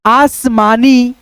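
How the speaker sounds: slow, stretched syllables; slowly sounding out आसमानी for a class. Shown as a demonstration. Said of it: "फिर यहाँ पे देखो".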